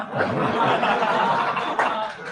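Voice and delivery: short real laugh; amused laugh after singing. Real, not acted. Laughs after song snippet.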